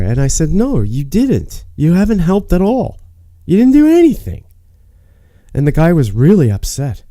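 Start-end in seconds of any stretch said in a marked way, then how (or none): 0.5-4.4 high, emphatic contradiction, rising as it goes; indignant retort. Retold or imitated. Patient telling the internist he didn't help, indignant.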